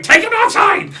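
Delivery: high, loud bellow over the brawl; small man roaring orders in a fight; shout. Acted. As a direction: yell it across a chaotic bar.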